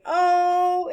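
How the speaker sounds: oh held out high and loud; drawn-out, ringing long O on oh; long vowel. Shown as a demonstration. Teacher showing what O stops saying, long vowel. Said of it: "does not say".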